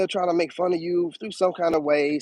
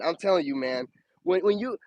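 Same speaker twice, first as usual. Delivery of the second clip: ordinary talk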